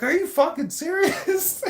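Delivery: high-pitched panicked disbelief; shocked disbelief. Retold or imitated. Imitating the terrified new inmate's shocked squeal. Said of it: "He's like".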